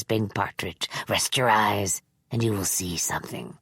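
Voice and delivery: put-on low hushed coaxing old man; sinister old man. Manx's held voice.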